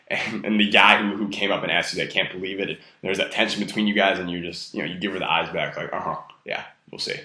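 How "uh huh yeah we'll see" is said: low, soft, unbothered reply; murmured, teasing brush-off; cool dismissal. Retold or imitated. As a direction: play it cool, quietly doubtful. Voicing the unbothered reply back.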